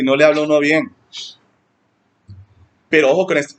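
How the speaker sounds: ordinary talk, nothing marked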